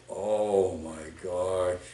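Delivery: slow, low, soft and stunned; stunned shock. Retold or imitated. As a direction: let it drain out of you, slowly. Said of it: "We're both like".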